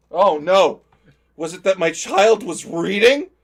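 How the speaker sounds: loud, slow sarcastic shock; 과장된 분노로 크고 느리게; mock outrage. Dad mock outrage at teacher.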